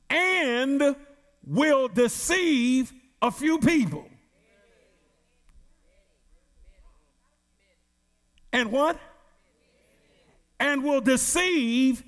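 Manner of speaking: slow, weighty scripture reading; slow, solemn preacher's reading; preaching scripture. Acted. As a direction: let each phrase land like a warning. Reads scripture in preaching cadence.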